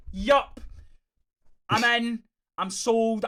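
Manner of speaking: high, loud burst of retold enthusiasm; excited reaction. Fan recalling being sold on the fight, excited. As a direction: pitch it up, instantly won over.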